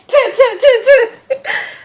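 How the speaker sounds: high, loud burst of laughter. Real, not acted. Laughing at her own finger-gun story, loud high burst of laughter. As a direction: crack up at yourself.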